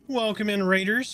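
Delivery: rally the incoming crowd; big streamer shout-out to new arrivals; excited greeting. Real, not acted.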